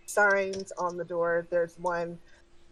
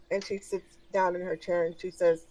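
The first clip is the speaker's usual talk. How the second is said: ordinary delivery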